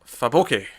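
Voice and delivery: "Faboke" deliberately mangled with a long o; weird pronunciation. Shown as a demonstration. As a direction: say "Faboke" oddly, stretching the second syllable. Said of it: "I'll have a weird pronunciation".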